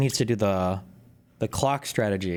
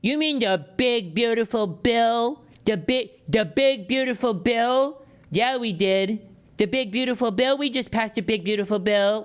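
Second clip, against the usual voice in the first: high, slow, dopey mock voice; drawn-out high mocking voice, bragging about the bill; mock dumb partisan; 12 semitones higher, 3 dB louder than usual. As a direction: ham up a clueless proud supporter. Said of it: "Did you guys just pass the budget?".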